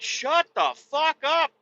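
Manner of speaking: high, slow mocking shout; high mimicking retort; mock yell. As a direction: throw it back at them mockingly. Answering them in mock voice.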